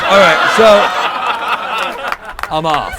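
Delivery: ordinary talk, nothing marked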